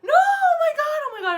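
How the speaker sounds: very high, loud panicked screaming; retold scream. Woman reenacting her fear at the car window, screaming. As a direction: shriek it in reenacted panic, easing at the end. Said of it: "I think I'm screaming".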